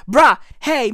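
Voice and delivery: ordinary delivery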